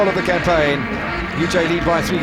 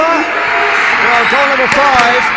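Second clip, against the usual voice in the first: excited goal call; 3 semitones higher, 5 dB louder, much slower than usual. Real, not acted. Commentator calls fifth goal.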